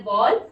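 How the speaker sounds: बॉल said with a short open o, like ball; बॉल with a round short vowel, not बाउल; teacher's model word. Shown as a demonstration. Teacher to pupils: बॉल with a short open o. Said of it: "ऐसे इसको भी pronounce किया जाता है बॉल".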